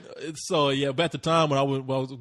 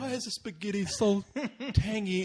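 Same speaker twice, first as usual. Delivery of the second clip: higher, softer puzzled voice, breaking into laughter; mock groggy diner; 5 semitones higher, 5 dB quieter than usual. Drugged guest questions the spaghetti, mock woozy. Acts himself drugged at dinner.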